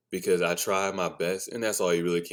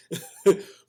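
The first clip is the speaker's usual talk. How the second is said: high burst of laughter; 11 semitones higher, 7 dB louder than usual. Real, not acted. Laughing at his own anger, high burst. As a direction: laugh it off loudly.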